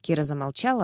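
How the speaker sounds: ordinary delivery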